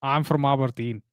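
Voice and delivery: put-on Aberdeen Scottish accent; amateur go at a Scottish accent; attempted Scottish accent. Shown as a demonstration. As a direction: pose as a Scottish local. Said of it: "in the Scottish accent is Aberdeen".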